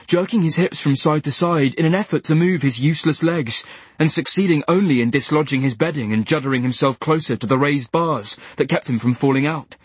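usual speaking voice

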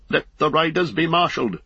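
high ringing order; commanding king. A narrator's reading. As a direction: send the riders out.

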